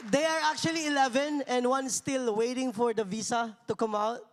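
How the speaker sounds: ordinary delivery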